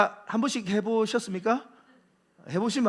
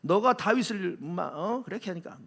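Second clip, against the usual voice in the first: ordinary talk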